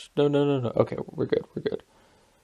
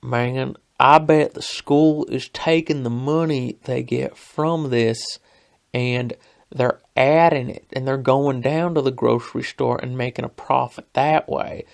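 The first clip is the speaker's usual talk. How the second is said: put-on Tennessee hills twang; drawling Tennessee country voice; Tennessee accent; 5 dB louder than usual. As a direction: imitate a Tennessee coworker spinning his theory. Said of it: "the guy who sounds more Tennessee".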